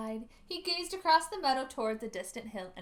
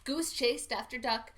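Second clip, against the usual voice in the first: usual speaking voice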